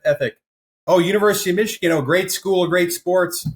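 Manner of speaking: excited gushing. Retold or imitated. Banker reading the resume, retold with excited gushing. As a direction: gush like a thrilled interviewer scanning a resume.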